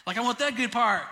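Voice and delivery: high, excited cheering; imitating an excited churchgoer; excited shouting. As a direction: cheer it like the best news. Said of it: "That gets us shouting and excited".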